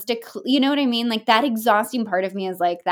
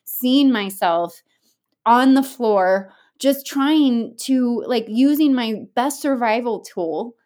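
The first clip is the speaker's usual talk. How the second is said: drawn out, louder; slow and emphatic; 3 semitones higher, 5 dB louder, slower than usual. Real, not acted. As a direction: stretch each phrase slowly.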